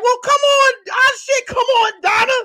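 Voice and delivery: high-pitched, worked-up egging on; acted fight challenge. Classmate daring Donna to fight, high and worked up. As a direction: reenact it in a high, hyped voice.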